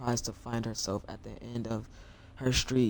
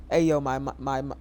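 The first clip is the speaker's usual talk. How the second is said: usual speaking voice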